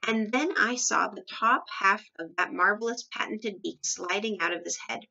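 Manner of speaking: ordinary delivery, nothing marked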